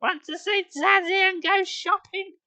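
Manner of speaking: very high, slow cooing mother; high drawn-out coo; cooing mum. Acted. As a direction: coo to the baby. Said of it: "she cooed at me".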